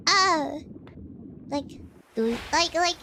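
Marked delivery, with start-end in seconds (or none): none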